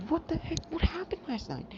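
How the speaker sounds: freaking out. Retold or imitated. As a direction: squeal it in total panic. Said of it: "both freaking out".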